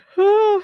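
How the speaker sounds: loud open yawn; big yawn. Real, not acted. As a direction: yawn out loud, high and long. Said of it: "Sorry, yawning".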